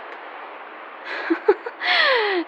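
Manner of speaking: light mocking laugh at his blushing; a quick amused laugh; teasing laugh.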